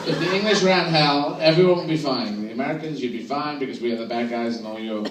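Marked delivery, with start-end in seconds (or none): none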